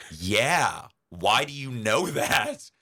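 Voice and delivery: mock astonishment; surprised retort. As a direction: imitate her incredulous reaction, stretched out slowly. Acts out her astonished reply.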